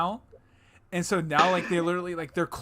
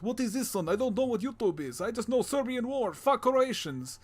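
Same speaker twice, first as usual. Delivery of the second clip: high-pitched mock Serbian dad accent; dad's foreign accent; 7 semitones higher than usual. Son plays Serbian dad baffled by YouTube, in a higher mock Serbian accent. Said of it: "Oh, sorry, doing wrong accent".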